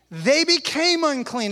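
ordinary talk, nothing marked

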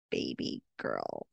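put-on low, slow, tender baby girl; murmured endearment; tender pet name. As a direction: drop the voice way down and linger. Quoting Doc's tender line.

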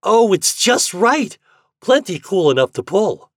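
very high, loud excitement; delighted exclamation. A narrator's reading. Fat tests the cooled candy, exclaiming. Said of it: "Fat exclaimed".

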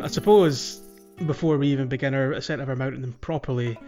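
normal talking voice

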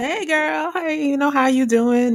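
put-on high, bright-pitched "hey girl" greeting; friend's cheery greeting. Friend calling on the phone, high cheery greeting. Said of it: "I was glad to hear it".

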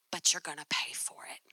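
low hushed catch; whispered warning. Retold or imitated. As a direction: drop to a knowing whisper. Manager's sly catch.

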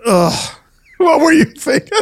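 high, laughing "ugh, what were you thinking"; mock cringing future self. Future self watching the episode, laughing and cringing up high. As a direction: groan at your own past self, laughing. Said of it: "I'll be like".

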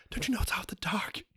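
urgent frightened whisper. Acted. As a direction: hiss the warning in fear. Scared mall clerk warning.

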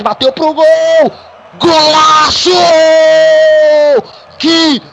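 screaming goal call, stretched and high; explosive goal commentary. Real, not acted. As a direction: roar the goal, drawing out every word.

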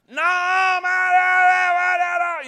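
high, loud, sputtering protest; mock angry outburst. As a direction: blurt it out in a furious denial. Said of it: "he's all mad, all angry".

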